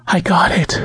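airy, submissive reply; breathless. A narrator's reading. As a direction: answer high and short of breath. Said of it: "she said breathlessly".

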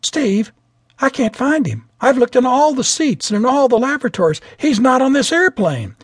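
frightened attendant reporting the missing captain. A narrator's reading. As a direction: voice her alarm at the missing captain. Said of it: "she looked frightened".

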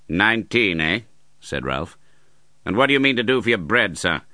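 sneering uncle. Acted. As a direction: needle him like a hard uncle. Ralph voiced.

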